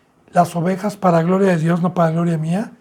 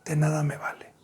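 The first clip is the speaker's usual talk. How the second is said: whisper; 3 semitones lower, 8 dB quieter than usual. Real, not acted. Preacher whispers to the church, de nada me vale. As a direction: drop to a breathy whisper on nada.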